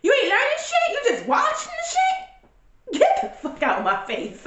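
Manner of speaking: put-on high, outraged mom scolding her kids; mother mock-ranting at kids who can't use social media. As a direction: yell at the lazy kids, pitch way up. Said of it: "So I'm like".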